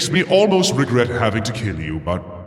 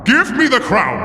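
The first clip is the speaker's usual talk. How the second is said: angry demand; 9 semitones higher than usual. Acted. Villain ordering Jerry to hand over the crown, angry. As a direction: snap it as a final demand.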